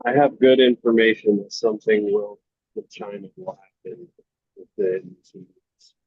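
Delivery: speaking through a muffled line; muffled voice, like behind a hand. Real, not acted. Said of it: "you sound a little muffled".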